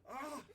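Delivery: high, loud frustrated "ah"; loud acted groan of annoyance; exasperated groan. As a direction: let out a fed-up cry. Crew member fed up with lookout.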